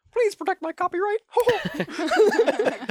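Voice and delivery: very high cartoon falsetto; high-pitched mascot squeal with a laugh; Mickey Mouse. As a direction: plead in a squeaky cartoon voice, then chuckle. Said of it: "very self-aware Mickey Mouse".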